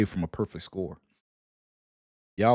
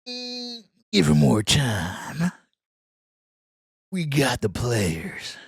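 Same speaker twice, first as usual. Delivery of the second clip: higher, slow mocking voice of a doubter; mocking quote; 9 semitones higher, slower than usual. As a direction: drag it out, mocking a fan. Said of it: "And Check Twist Watch said".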